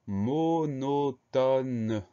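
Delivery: "monotone" very slow, every syllable held long. Shown as a demonstration. Teacher presenting a very long word, "monotone" syllable by syllable. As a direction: stretch "monotone" right out, one syllable at a time. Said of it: "Première syllabe".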